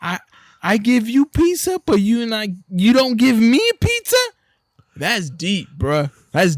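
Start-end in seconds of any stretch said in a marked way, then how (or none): 0.0-4.2 put-on whiny little kid. Retelling a viral video, voicing the wounded kid whining. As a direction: whine it like a hurt little kid. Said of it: "The little kid's like".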